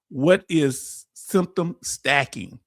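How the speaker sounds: each word drawn out slowly, like a headline; slow emphatic topic reveal. Real, not acted. Announcing tonight's topic slowly.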